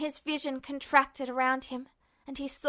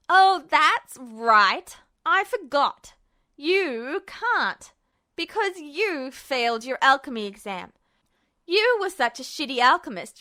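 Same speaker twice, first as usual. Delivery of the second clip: Ed's cruel mockery; 3 dB louder than usual. Ed mocks Justin's failed exam, cruel. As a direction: savour the insult.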